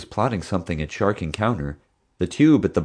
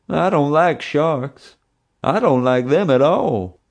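much higher, slow, frightened whine; scared little kid; 8 semitones higher, 4 dB louder, slower than usual. A narrator's reading. Big policeman Bubba trapped among sharks, high slow scared whine. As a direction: whimper it like a terrified child. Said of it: "he now seemed like a little kid".

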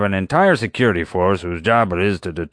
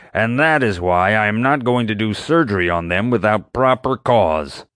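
angry; 4 semitones higher, 4 dB louder than usual. Acted. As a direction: spit out each word, offended. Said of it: "Doc grew angrier than I'd ever seen him".

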